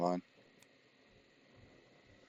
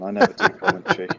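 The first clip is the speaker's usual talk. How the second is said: sarcastic; 10 semitones higher, 5 dB louder, faster than usual. Pretend. Joking denial while commentating.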